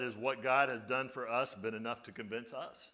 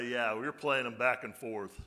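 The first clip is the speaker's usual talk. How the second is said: ordinary delivery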